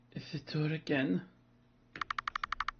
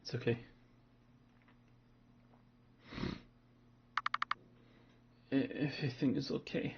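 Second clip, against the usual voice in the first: shaken murmur; 7 dB quieter than usual. Acted. Shaken child calms self quietly.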